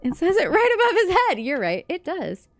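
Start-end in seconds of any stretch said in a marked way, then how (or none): 0.0-1.4 laughing. Real, not acted. Laughing at own mistake.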